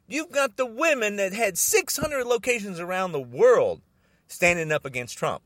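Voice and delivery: usual speaking voice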